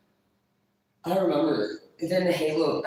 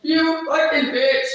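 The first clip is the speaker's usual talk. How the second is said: higher, louder insult; mock yell; 10 semitones higher, 6 dB louder, much slower than usual. Real, not acted. Yelling a playful insult at a viewer.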